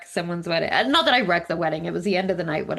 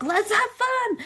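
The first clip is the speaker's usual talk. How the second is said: mock cheery invite; 13 semitones higher, slower than usual. Mother cheering quiet family.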